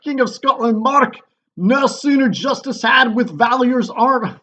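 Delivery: loud, high, hammed-up Scottish captain; terrible Scottish accent. Wounded captain reports the battle to the king, mock Scottish shout. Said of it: "my terrible Scottish accent when I read the part of the captain".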